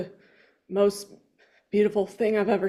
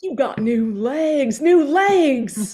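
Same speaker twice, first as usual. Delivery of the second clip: amazed, louder on the second; excited; 5 semitones higher, 5 dB louder, much slower than usual. Acted. Forrest exclaims to Lieutenant Dan about his legs, amazed. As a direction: marvel out loud at the new legs.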